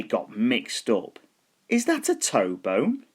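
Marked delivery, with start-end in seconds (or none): 1.7-3.1 high, puzzled little skeleton; a small skeleton unsure of the bones. A narrator's reading. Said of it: "the little skeleton said".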